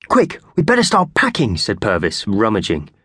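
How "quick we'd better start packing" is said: high squeaky urgency; eager mouse voice. A narrator's reading. Purvis the mouse urging the others to pack. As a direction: hurry everyone along like a tiny mouse.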